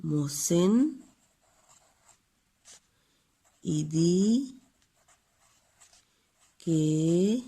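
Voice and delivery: each word spaced out slowly; pausing between words for the pupils to write; slow dictation. Shown as a demonstration.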